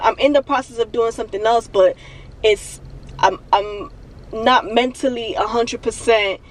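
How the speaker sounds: usual speaking voice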